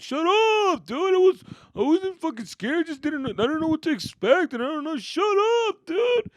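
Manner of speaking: high, whiny, loud protest; defensive kid. Acted. Scared kid to teasing cousins, high whiny defensiveness. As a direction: protest like a kid near tears.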